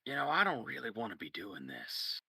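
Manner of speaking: quietly concedes he doesn't want this. Acted. Boss quietly admitting to the player he doesn't want this. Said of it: "the way you phrased it".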